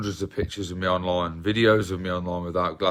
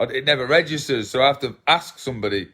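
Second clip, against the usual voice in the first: ordinary talk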